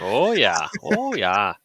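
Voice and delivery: drawn-out Minnesota "oh, yeah"; light Minnesota accent, folksy. Retold or imitated. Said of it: "I'm stuck back in that Minnesota accent".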